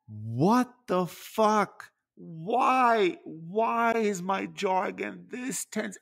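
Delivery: high, slow, drawn-out disbelief; exasperated inner voice. Retold or imitated. Fed up with his tense jaw, exasperated and pleading. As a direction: throw up your hands at your own jaw. Retelling own frustration at jaw.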